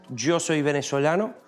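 ordinary talk, nothing marked

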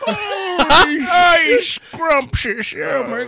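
mock old-man swoon, very high and drawn out; hammed-up old man accent. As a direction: cry it out ecstatically in a silly high voice, stretching the words. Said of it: "but in that accent".

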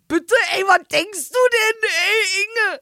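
high, loud, outraged yell at Inge; mock outrage. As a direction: play it hammed-up indignant, shouting. Mock outrage at the partner's pay. (gespielt empörter, hoher Aufschrei)

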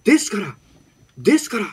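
impassioned, high-pitched Kansai-tinged political speech; Yamamoto Taro impression. Announcer obliges a listener's request for a politician, impassioned high-pitched impression. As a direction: plead like a fiery opposition politician, pausing between bursts. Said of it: "某山本さんのモノマネ".

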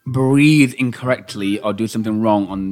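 hammed-up grievance shouted at the co-host; mock outrage. Calling out the co-host for crunching ice, mock-angry and loud.

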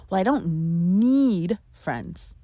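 "need" held long, a beat before "friends"; stress on NEED. Shown as a demonstration. Breaking down the second version, stress on "need". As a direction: stretch "need" and set it apart. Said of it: "Then there's the".

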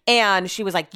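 ordinary talk, nothing marked

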